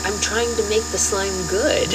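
put-on high little-kid voice; four-year-old niece. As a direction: mimic an earnest small child explaining herself. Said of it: "And she said".

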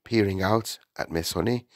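plain reading voice

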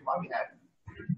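mock the wife's weary grumble, breathed out slowly; imitating wife's complaint. Retelling his wife's complaint about the dog, whispered mock grumble. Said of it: "It's always like".

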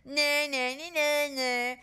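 high playground singsong taunt; nyah-nyah jeering; jeered. A narrator's reading. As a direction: chant it to mock a rival. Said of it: "jeered Margaret and Susan".

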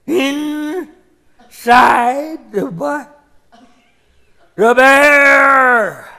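put-on cranky old-timer retelling the same tall tale; old-timer storyteller. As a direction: spin the yarn like a loud creaky old codger. Old man builds the tale.

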